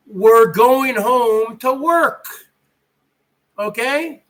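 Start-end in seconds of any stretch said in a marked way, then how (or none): none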